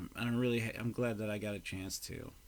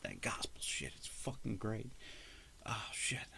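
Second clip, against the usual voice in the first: whispered rave; awed whisper; 7 dB quieter than usual. Real, not acted. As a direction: whisper it, breathless with admiration.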